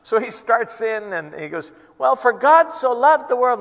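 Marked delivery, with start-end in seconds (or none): 2.0-3.6 higher, louder nervous preach; street preaching. Retold or imitated. New believer preaching to gather a crowd, loud. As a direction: launch into a street sermon.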